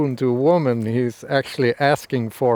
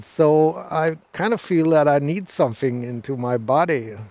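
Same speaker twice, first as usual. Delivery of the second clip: usual speaking voice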